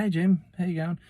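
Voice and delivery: high-pitched hello, imitating a shop girl; imitated greeting, pitched well up; retold cheery greeting.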